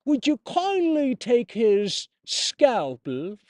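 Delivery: slow, drawn-out mock British narrator; mock British game voice. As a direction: ham up the audio-log voice slowly.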